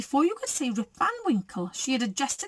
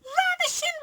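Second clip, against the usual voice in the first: very high mother bear voice; Mama Bear reading. A narrator's reading. Mama Bear reads the perfume bottle, high voice. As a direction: read it out in a high voice.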